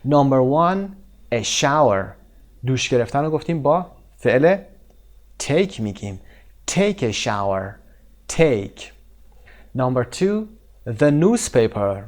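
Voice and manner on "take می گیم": usual speaking voice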